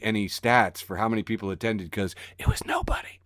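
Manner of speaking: unvoiced whisper aside. Real, not acted. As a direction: whisper it like a secret jab.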